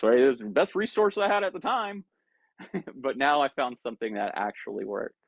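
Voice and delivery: ordinary talk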